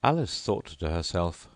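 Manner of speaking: usual speaking voice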